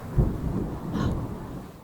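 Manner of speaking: long breathy pretend gasp; startled gasp. Startled by thunder sound.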